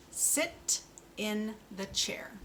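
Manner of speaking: "Sit in the chair" said slowly and carefully; slow model sentence. Shown as a demonstration. Speech therapist models a sentence for a child, slow. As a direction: give each word its own time. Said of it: "Let's try that sentence".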